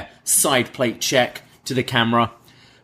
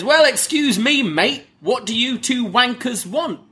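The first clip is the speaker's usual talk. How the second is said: much higher mock British accent; lightly hammed-up English bloke; British accent impression; 12 semitones higher than usual. Bron mimicking the English tag champs, British accent. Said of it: "Bron tries an impression of his own".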